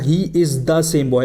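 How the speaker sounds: slow, word by word example; slow example quote. Shown as a demonstration. Teacher voicing Janvi telling her father, slowly. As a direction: drag out each word to teach. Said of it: "वो बोलेगी".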